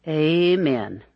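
"Amen" with a long, drawn-out A as in day. A narrator's reading. Bishop blessing the engagement, "Amen" with a long Baptist A. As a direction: say "Amen" as AY-men, old Baptist style. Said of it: "the old Baptist way with a long A".

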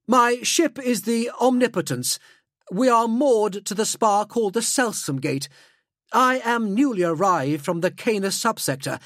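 high-pitched aristocratic chatter; Helmont Corval. Acted. Helmeted noble telling a new acquaintance of his ship, high and formal. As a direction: hold forth as a courteous, high-voiced noble. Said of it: "his voice reverberating through the mouth grill".

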